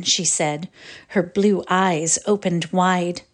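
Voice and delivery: ordinary delivery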